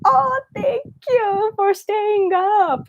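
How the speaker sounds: high, lively hello to a viewer; high bright greeting. Real, not acted. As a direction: greet them warmly and high. Greeting late night viewer.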